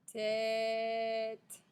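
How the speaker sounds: TÊTE with a long sustained ê; elongated vowel. Shown as a demonstration. Teacher repeats for pupils, "tête" stretched. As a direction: hold the middle of "tête". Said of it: "c'est bien le son est".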